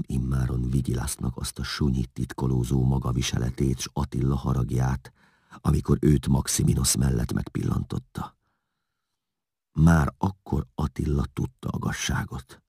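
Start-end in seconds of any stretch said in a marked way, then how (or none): none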